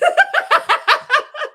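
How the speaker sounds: real high-pitched cackle of delight; high burst of laughter. Real, not acted. Laughing out loud at chat's cheese joke. Laughing after reading chat.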